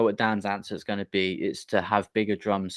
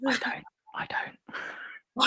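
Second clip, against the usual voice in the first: whisper; 5 dB quieter, much slower than usual. Pretend. Mock denial after sympathy.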